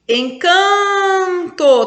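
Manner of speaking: slow, drawn-out demo of a word; slow stretched reading. Shown as a demonstration. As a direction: hold each syllable to show the count. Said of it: "porque são sílabas átonas".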